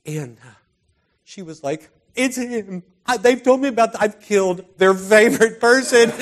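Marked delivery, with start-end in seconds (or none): 2.2-6.1 higher, much louder panicked cry; panicked realization. Retold or imitated. Girl realizing she hit the beloved pastor, panicked loud cry. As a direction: blurt it out in alarmed shock.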